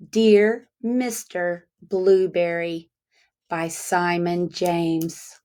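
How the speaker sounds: slow, measured title read; reading a title aloud. Acted. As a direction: announce the book title to the class. Reads title to children.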